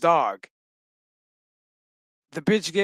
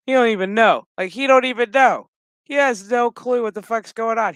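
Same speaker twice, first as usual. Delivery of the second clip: high, loud and worked up; indignant pity; 7 semitones higher, 6 dB louder than usual. Real, not acted. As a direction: protest loudly on the poor dog's behalf. Indignant on the dog's behalf.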